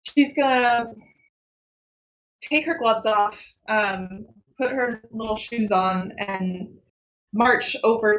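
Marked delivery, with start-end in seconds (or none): none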